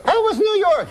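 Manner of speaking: very high French wife voice; put-on high feminine voice; Mary Claire. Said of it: "high voice".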